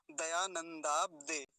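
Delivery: slow, word split into parts; careful drawn-out demonstration; model pronunciation. Shown as a demonstration. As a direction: say it piece by piece. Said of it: "अगला शब्द देखिए".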